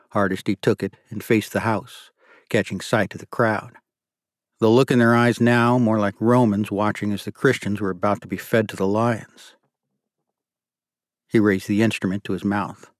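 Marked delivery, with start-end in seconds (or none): none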